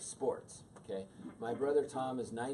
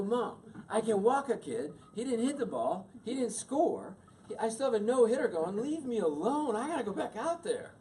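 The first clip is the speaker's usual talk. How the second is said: high, loud whine at mom; defensive, raised-voice retort; exasperated teen protest; 10 semitones higher, 6 dB louder than usual. Retold or imitated. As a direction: argue back like an indignant kid, voice pitched way up. Retelling his teenage argument with mother.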